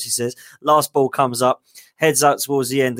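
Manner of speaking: usual speaking voice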